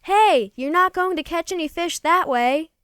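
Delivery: a loud hail from close by; closer call. A narrator's reading. Bigfoot calling to the fisherman from close behind, loud hail. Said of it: "this time it was much closer".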